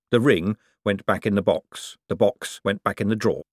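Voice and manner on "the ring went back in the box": ordinary delivery, nothing marked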